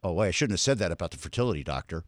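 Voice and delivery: quick, soft, low-pitched mutter; low aside. Real, not acted. Radio host catches own slip about departed guest, low aside. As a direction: drop it low and rush it under your breath.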